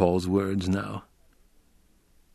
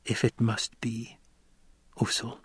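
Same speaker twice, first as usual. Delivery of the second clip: whispered; 7 dB quieter than usual. A narrator's reading. Chani giving way to Paul, whispering. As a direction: whisper it with tears held back. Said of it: "Chani whispered".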